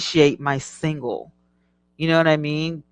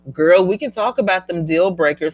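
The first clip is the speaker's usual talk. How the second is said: ordinary talk, nothing marked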